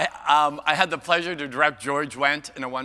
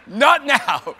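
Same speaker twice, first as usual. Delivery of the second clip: high, loud, breaking into laughter; laughing protest; 12 semitones higher, 13 dB louder than usual. Real, not acted. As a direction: cry out through real laughter. Laughing at audience member googling.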